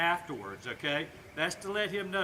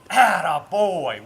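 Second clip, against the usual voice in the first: slow, loud, over-the-top praise; big praise; 13 dB louder, slower than usual. Shown as a demonstration. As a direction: come over the top with pride. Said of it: "let him know how proud we are".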